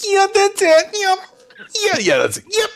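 put-on very high breathy baby voice; squeaky baby voice murmur.

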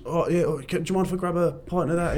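pub regular at the bar. Retold or imitated. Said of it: "over the top of someone's shoulder".

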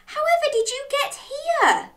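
high-pitched, amazed dog; astonished question. A narrator's reading.

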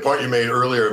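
ordinary delivery

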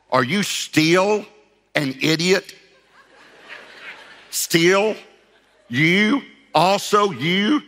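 slow, high, word-by-word mock scolding; harsh incredulous rebuke. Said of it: "that was a little harsh".

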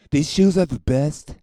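loud, high, hammed-up salesman; mock Trump sales pitch. Trump hawking his gold sneakers, hammed-up loud pitch. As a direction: oversell the shoes like a huckster.

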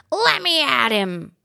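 gruff, slow little dog voice; barking terrier. A narrator's reading. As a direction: growl it out like a feisty terrier. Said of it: "barked the little terrier".

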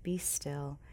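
hushed whisper; whispering God's gentle call. Acted. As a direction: breathe the words like a secret. Quoting God's quiet voice.

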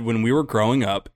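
ordinary talk

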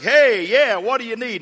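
high, loud, offhand "hey, yeah"; too-casual God. Acted. God answering a caller, mock casual and loud. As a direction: holler back like a bothered buddy. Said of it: "if he talks to you like that".

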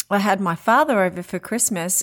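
ordinary delivery

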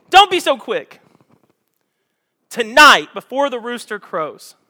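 high, loud warning; Jesus warning. Acted. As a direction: call the warning out firmly. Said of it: "And Jesus goes".

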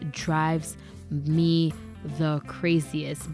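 normal talking voice

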